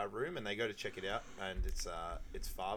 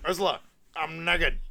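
higher, louder goofy announcement; mock O'Hagan; 7 semitones higher, 9 dB louder than usual. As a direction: blurt it out like a proud drunk. Voicing O'Hagan in retold scene.

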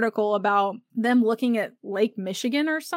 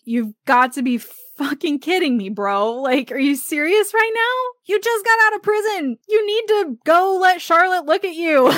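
higher, disbelieving bro voice; exasperated Jack; 7 semitones higher, 3 dB louder than usual. Retold or imitated. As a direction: scold a reckless friend in disbelief. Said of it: "and is like".

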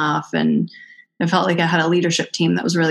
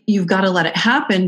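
ordinary talk, nothing marked

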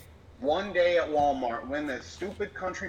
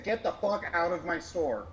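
ordinary delivery

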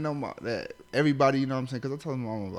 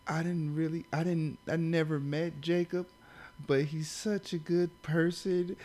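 higher, slow, mock tearful plea; mock crying lady; 5 semitones higher than usual. Mimicking a crying stranger at his brother's funeral, mock tearful. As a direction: imitate the weeping stranger's tribute, drawn out. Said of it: "she's bawling in tears".

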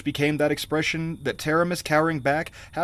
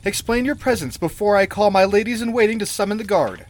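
high, loud queenly demand; indignant queen, voice raised high; alarmed command; 6 semitones higher, 5 dB louder than usual. A narrator's reading. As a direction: order the intruder to explain. Alarmed queen threatens the intruder.

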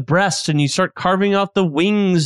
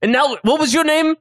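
lightly voiced chef, higher; 7 semitones higher than usual. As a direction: chef politely asking the cook's name. Chef voice addressing Kevin.

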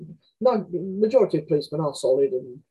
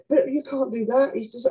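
pitched-up protesting neighbour, slowed; high indignant woman; 8 semitones higher than usual. Retold or imitated. Neighbour scolding mother at the door, high indignant protest. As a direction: mimic the outraged neighbour, higher.

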